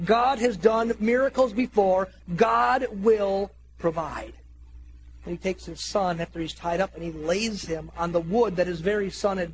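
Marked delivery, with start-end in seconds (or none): 0.1-4.3 slow, emphatic proclamation; faith declaration. Acted. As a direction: stretch each word as you declare it. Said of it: "his declaration".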